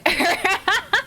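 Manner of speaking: high burst of giggles; real high laugh. Real, not acted. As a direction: break into a high-pitched giggle. Amused by own Eldritch remark.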